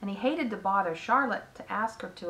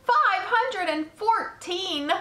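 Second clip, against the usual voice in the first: high, loud, drawn-out disbelief; astonished shout; 11 semitones higher, 5 dB louder, slower than usual. A narrator's reading. Pig repeats spider's egg count, shouting in amazement. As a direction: shout it slowly, pitched way up. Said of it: "shouted Wilbur".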